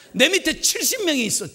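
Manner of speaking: high, self-important boast; boastful brag. Retold or imitated. Pastor imitating a customer bragging about seventy employees, boastful. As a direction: puff up and brag.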